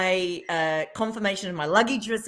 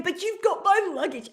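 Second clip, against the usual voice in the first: mock tearful; 10 semitones higher than usual. Crying retold, high.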